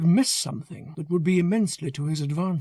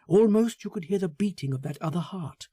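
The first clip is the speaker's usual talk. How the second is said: ordinary delivery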